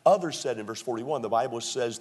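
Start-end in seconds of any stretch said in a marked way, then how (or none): none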